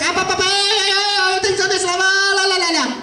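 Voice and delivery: very high, squeaky cartoon chatter; original Appa Chai voice. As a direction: pitch way up, sing-song gibberish. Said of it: "The original Appa Chai sounds like this".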